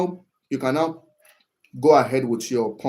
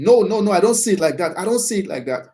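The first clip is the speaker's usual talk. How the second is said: loud, high protest, no no no; mock shouting; 7 semitones higher, 5 dB louder, faster than usual. Shown as a demonstration. Demonstrating the wrong way to disagree with your boss, raised voice. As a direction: bark it out like a defensive employee arguing. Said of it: "shouting and saying".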